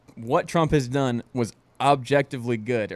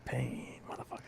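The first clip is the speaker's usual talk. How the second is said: whispered menace, much softer; 17 dB quieter than usual. Retold or imitated. Quoting Clubber Lang to the co-host, whispered. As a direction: breathe it out like a quiet threat. Repeating boxer quote.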